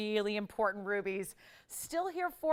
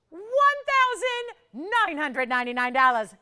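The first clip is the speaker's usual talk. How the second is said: price reveal; 7 semitones higher, 9 dB louder, faster than usual. Acted. Host announcing the price.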